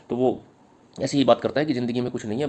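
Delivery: ordinary talk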